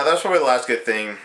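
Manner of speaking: ordinary talk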